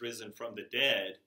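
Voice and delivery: ordinary talk, nothing marked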